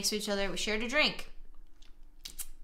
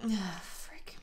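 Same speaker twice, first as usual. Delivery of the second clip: unvoiced frustrated whisper; whispered frick; 4 semitones lower, 11 dB quieter than usual. Real, not acted. Gamer whispering frustration at a choice. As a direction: hiss it out quietly.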